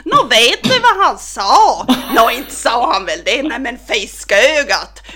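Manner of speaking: loud, lively Norrbotten women chatting, slow at times; Norrbotten kitchen chatter. Retold or imitated. Granddaughter imitating mormor and mamma chatting in Norrbotten dialect, loud and lively. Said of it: "då fortsätter det bara så här".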